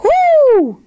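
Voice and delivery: drawn-out high loud hoot; calling out. A narrator's reading. Julebug galloping over the ice, hooting loudly. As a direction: hoot it out, falling at the end. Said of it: "rief Juleburg".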